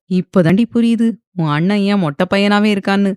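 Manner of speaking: ordinary delivery, nothing marked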